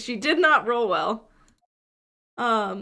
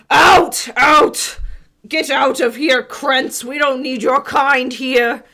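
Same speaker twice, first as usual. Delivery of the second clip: loud, high shouting bouncer; dopey cartoon bellow; 4 semitones higher, 7 dB louder, slower than usual. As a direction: yell them out of the bar. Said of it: "a deep human voice yell".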